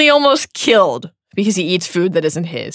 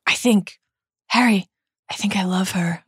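a touch strangled, halting between phrases; 3 dB quieter than usual. A narrator's reading. Ron confessing love to Harry, mildly strangled. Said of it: "said Ron in a strangled voice".

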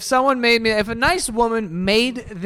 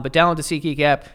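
ordinary delivery

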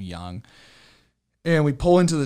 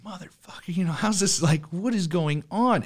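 retold disbelief; 9 semitones higher than usual. Friend watches his car burn.

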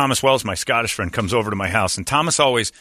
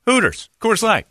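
cheery thanks; 7 semitones higher than usual. Pretend. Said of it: "look around and say".

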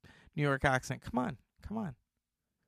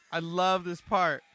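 ordinary talk

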